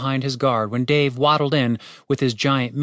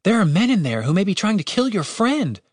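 higher-pitched, insistent hero voice; Julian, arguing; 8 semitones higher than usual. A narrator's reading. Julian urges Cooper to rescue their friend, arguing. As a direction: argue urgently in a higher character voice.

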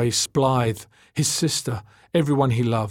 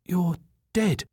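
ordinary delivery

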